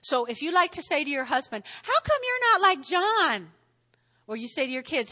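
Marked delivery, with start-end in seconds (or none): 1.8-3.5 higher-pitched complaining question; mock nagging. Wife comparing husband to another man, mock nagging. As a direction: needle him with the comparison.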